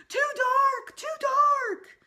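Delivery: high, loud, terrified wail; frightened cry. A narrator's reading. Scared boy wailing when the lights go off, high and loud. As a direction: wail it like a child scared of the dark. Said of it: "Sam cried".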